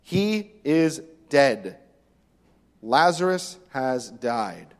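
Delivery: very slow, weighty words; plain grave statement. Retold or imitated. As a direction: drop each word slowly and plainly. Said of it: "he speaks plainly to them".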